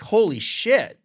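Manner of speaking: loud, high awestruck exclamation; retold burst of awe; amazed. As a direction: blurt it out in amazement. Retold amazement at surf house.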